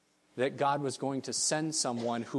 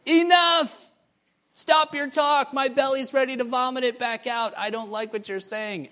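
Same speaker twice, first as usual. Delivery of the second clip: high, loud, furious outburst that fades at the end; angry shout; 10 semitones higher, 6 dB louder than usual. Retold or imitated. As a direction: shout them down in disgust. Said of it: "they'll stand in the back and they'll say".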